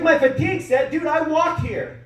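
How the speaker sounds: high-pitched, incredulous comeback; indignant protest. Acted. Worn-out beginner answering a gym coach, indignant protest. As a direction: protest in a high, put-upon voice.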